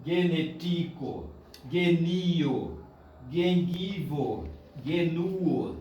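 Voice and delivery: slow "Genetico, genio", leaning on the next-to-last syllable; penultimate stress. Shown as a demonstration. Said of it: "a tônica tá lá sempre na penúltima".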